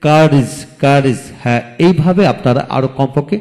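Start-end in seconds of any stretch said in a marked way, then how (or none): 0.0-1.2 slow demo word. Shown as a demonstration. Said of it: "যেমন".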